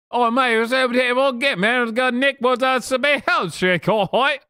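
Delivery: high, fast mock Cockney gabble; fake Cockney accent. Streamer parodying a British chatter in a fast fake Cockney accent.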